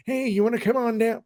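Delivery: put-on high-pitched old-timer with a country twang; hammed-up elderly collector calling a pal over; old man. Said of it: "you don't sound like that at fifty".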